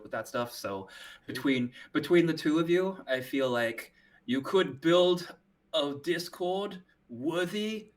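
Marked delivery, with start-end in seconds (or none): 5.7-7.9 New Zealand accent. Real, not acted. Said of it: "your New Zealand accent is showing".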